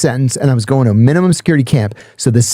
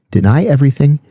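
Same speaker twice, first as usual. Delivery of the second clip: ordinary delivery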